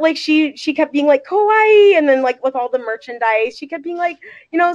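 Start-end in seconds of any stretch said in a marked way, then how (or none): 1.2-2.0 high, loud delighted squeal; excited, pitched-up exclamation; retold gushing. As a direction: imitate a mom cooing over cute merch. Retold mother gushing over merchandise.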